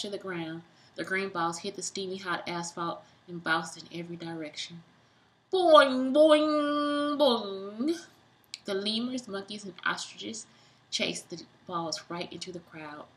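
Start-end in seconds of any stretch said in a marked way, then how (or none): 5.5-8.0 high boings, fading down; bouncy boings. Acted. Reader voicing tennis balls bouncing, springy boings. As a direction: bounce each one.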